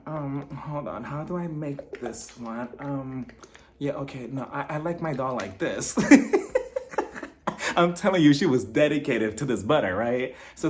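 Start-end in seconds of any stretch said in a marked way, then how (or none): 0.1-6.0 soft, half-absent mumble; distracted muttering. Acting out Meredith busy with her doll, distracted and soft. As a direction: mutter while your mind is elsewhere.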